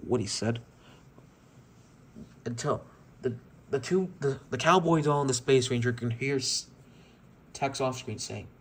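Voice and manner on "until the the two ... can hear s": ordinary delivery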